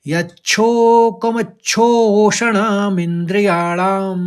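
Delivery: slow chant, three parts linked, stress on यच्छो; joined recitation. Shown as a demonstration. Teacher reading the second line together for students. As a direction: read it all together with a light break after यच्छो. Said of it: "एक साथ पढ़ने में".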